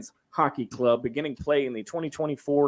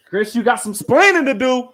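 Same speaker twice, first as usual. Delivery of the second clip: mock scolding; 8 semitones higher, 10 dB louder than usual.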